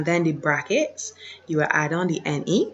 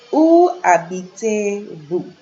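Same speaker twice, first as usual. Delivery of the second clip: où habitez-vous, drawn out and slow; slow teaching read; 3 semitones higher, 5 dB louder, slower than usual. Shown as a demonstration. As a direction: model the question slowly for the class.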